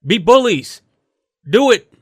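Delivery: loud, high, punchy commands; emphatic urging. Real, not acted. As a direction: fire it out like an order to the team. Host urging players to bully.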